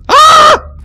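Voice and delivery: high piercing scream. Acted. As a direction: let out a shrill scream. Scream at the creep.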